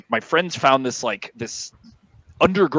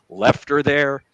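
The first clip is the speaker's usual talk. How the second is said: choked up; 3 semitones higher, 5 dB louder than usual. Real, not acted. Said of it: "I'm getting choked up".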